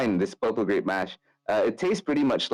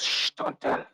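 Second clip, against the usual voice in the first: secretive whisper; whispered hush. Acted. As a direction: keep it a secret. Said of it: "Carl just goes".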